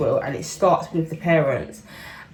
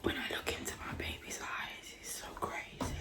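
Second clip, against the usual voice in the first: whisper; 18 dB quieter than usual. Real, not acted. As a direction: breathe the words out softly. Whispering beside sleeping baby.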